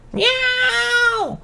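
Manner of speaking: very high, piercing cat cry; cat soldier meow. Acted. A soldier Meowie yowls before charging the twins.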